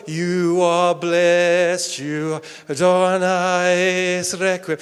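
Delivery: slow, drawn-out mock solemn intoning. Mocking a solemn church reading of the blessing. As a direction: intone it like a pompous liturgy. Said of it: "in a solemn sort of".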